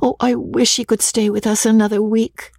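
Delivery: high, lightly voiced pleading; wistful Amelia. A narrator's reading. Daughter wishes her friend could stay, mildly pleading.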